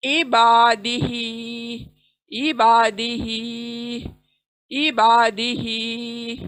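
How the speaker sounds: इबादिही with बा and ही stretched slowly; slow tajweed reading of इबादिही; drawn-out recitation. Shown as a demonstration. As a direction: hold बा and ही in इबादिही, the rest quick. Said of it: "बा खींचना है, ही खींचना है".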